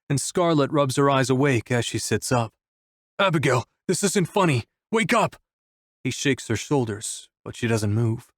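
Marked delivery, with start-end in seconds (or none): 3.2-5.4 high, urgent plea; frantic pleading. A narrator's reading. Wolf shakes Abigail to wake her, frantic. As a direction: panic climbing in pitch to the last words.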